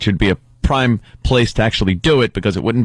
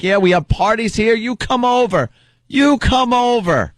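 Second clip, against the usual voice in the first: imitating Keith; 11 semitones higher than usual. Keith invites the neighbour to parties, high and eager. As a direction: lightly voice Keith, gushing an invitation. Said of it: "Keith is like".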